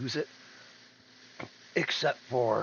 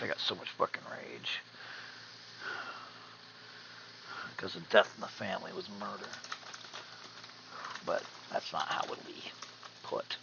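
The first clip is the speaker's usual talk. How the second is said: whispering; 3 semitones lower, 6 dB quieter than usual. Real, not acted. Quiet rage over family murder.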